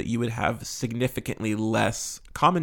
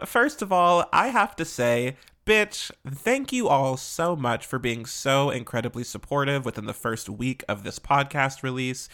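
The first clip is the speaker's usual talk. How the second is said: ordinary delivery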